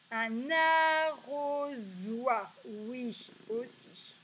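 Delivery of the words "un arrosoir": "arrosoir" slowly in long syllables with buzzed z; audible Z sound. Shown as a demonstration. Teacher testing for the Z, "arrosoir" syllable by syllable. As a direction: stretch "arrosoir" so the z stands out. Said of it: "oui, on entend le son Z".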